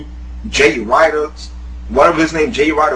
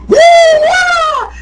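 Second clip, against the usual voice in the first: high, loud mocking frat call; mock frat whoop; 6 dB louder than usual. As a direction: ham up a college frat guy's whoop. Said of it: "acting like a fucking buffoon".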